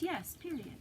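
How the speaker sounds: lightly voiced little boy answering; a hint of a child's reply; voicing the child. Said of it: "And he says".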